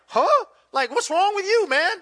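high, loud, incredulous reaction; surprised. Retold or imitated. Reacting to a pastor who said no, surprised. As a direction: blurt it out in disbelief, pitch leaping up. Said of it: "I was surprised".

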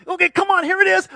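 will them to hit the note; urging excitement. Retold or imitated. Parent urging a child toward a high note, excited. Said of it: "and you're like, go".

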